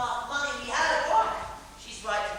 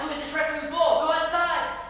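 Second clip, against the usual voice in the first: agitated; 5 semitones higher, 9 dB louder than usual. Retold or imitated. Frightened retelling.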